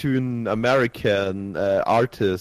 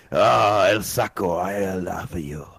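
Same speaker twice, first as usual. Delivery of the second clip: mock Spanish lover; 4 semitones lower, slower than usual. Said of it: "angry Italian or Spanish".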